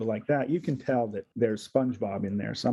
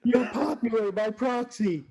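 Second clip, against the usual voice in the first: much higher, sing-song cheering fan; mock cheer; 11 semitones higher, slower than usual. Fans cheering his friends, high sing-song mock cheer. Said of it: "They're like".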